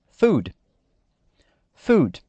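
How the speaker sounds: "food" ending on a clean d, no vowel after; model English word. Shown as a demonstration. Teacher modeling the last word for students, clear English. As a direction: say "food" crisply, stopping on the d. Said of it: "E a última".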